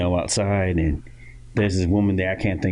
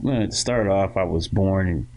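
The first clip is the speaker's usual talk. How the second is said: usual speaking voice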